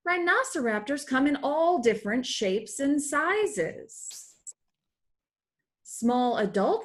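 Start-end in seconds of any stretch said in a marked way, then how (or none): none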